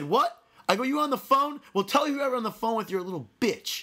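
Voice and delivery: high, combative challenge; indignant roadside taunt; confrontational taunt. Retold or imitated. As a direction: re-enact picking a fight, pitch jumping up. Acted taunt to other driver.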